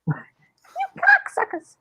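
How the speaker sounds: very high, slow chipmunk squeak. Retold or imitated. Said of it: "I made it sound like a chipmunk".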